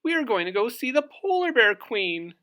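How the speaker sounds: a proud little bird answering; high eager bird voice; Loony Little's voice. Acted. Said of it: "said Loony Little".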